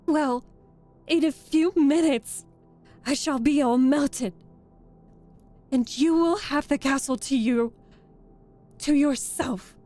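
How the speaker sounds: loud, despairing last speech; wailing witch. A narrator's reading. Dying witch bequeathing her castle to the girl, wailing. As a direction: lament loudly as you melt away. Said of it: "in a wailing, despairing voice".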